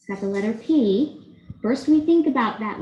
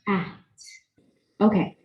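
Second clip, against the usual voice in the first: usual speaking voice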